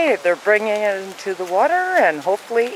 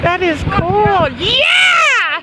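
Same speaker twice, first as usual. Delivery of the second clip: excited cheer; 8 semitones higher, much slower than usual. Real, not acted. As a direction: cheer it on with delight. Cheering the working contraption.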